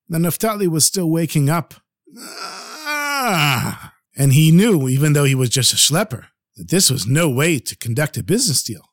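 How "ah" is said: sudden high cry of surprise; startled cry. Acted. Sleeping man woken by the miser, startled cry. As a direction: jolt awake with a sharp yelp. Naphtali jolted awake.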